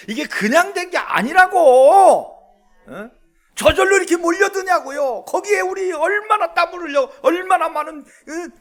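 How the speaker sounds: high, loud, forceful exhortation; fired-up preacher challenging the congregation; impassioned preaching. Real, not acted. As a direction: drive the point home at full volume.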